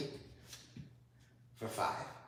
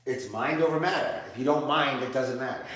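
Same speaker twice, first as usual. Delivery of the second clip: excited quip; 3 semitones higher, 11 dB louder than usual. Real, not acted. Teacher joking with her student after the plank, excited and laughing. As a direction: toss off the motto with glee.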